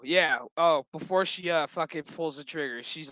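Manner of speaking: ordinary talk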